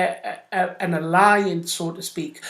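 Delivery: usual speaking voice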